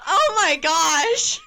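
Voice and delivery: loud, high, drawn-out exclamation; scandalized outburst. Real, not acted. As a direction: shout it in laughing outrage. Outraged at friend's teasing word.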